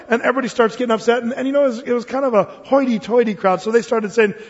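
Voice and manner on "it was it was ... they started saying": ordinary talk, nothing marked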